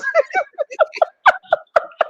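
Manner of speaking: big high-pitched laugh burst; high wheezing laugh. Real, not acted. Host cracking up at the movie scene, high laughing fit. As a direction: let the laugh run away with you.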